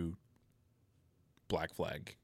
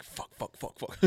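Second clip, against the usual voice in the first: whispered panic; 2 semitones higher, 8 dB quieter than usual. Retold or imitated. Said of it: "I was like".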